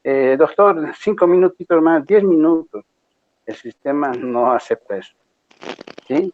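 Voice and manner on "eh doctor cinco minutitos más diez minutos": higher, wheedling student begging for a few more minutes; pleading student. Retold or imitated. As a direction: beg the doctor like a late student. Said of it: "No es como decir". (estudiante suplicante, voz más aguda)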